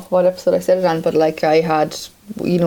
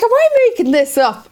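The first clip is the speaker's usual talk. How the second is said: incredulous high question; 4 dB louder than usual. Retold or imitated. Retelling anxious question to doctor.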